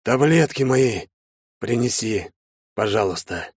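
weak, halting croak; sick man rasping a request; hoarse wheeze. A narrator's reading. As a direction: wheeze it out in broken pieces. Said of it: "прохрипел".

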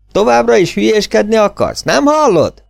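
high, loud, pleading yell with continuing to fool around shouted out; exasperated shout. A narrator's reading. Emerson yells at hiding Gordon, exasperated shout. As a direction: yell it into the night, losing patience.

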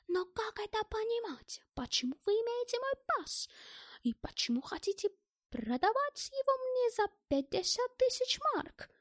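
high-pitched agitated German character; overly emotional. A narrator's reading. German protests at being sold his passport, overly emotional. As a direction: pile up indignant questions in a very high voice. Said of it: "Гельмут становился излишне эмоциональным".

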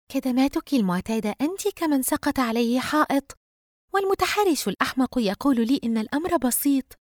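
put-on high, animated young woman's voice; exasperated best friend. Friend complains about Yusra's bruises and the doctor, exasperated.

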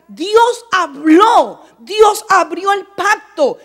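slow, shouted proclamation; shouted preaching. Real, not acted. Preacher proclaiming God's covenant, shouting. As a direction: cry it out to the church.

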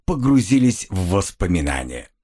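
ordinary delivery, nothing marked

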